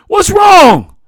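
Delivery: high, very loud, desperate plea; shouted plea. A narrator's reading. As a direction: plead at full shout for an answer. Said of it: "he pleaded".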